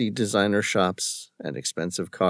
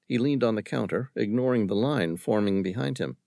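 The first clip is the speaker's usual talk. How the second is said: ordinary talk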